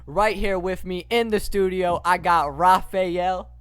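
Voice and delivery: big, loud show-opening announcement; punchy hype-man welcome; podcast host intro. Acted. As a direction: kick off the show at full energy.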